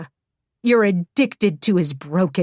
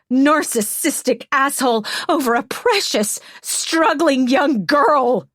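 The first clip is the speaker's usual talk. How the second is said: raging; 8 semitones higher, 5 dB louder than usual. Acted. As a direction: scream the words out in rage. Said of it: "almost incandescent with rage".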